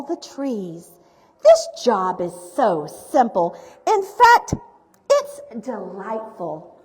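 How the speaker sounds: projected, rhyming picture-book narration; story reading to children. A narrator's reading. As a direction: read the rhymes out with big, deliberate emphasis.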